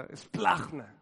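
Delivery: esplagna said slowly and clearly as es-plag-na, stretching the final a; Greek word demo. Shown as a demonstration. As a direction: model the Greek esplagna for the congregation to repeat. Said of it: "así se dice".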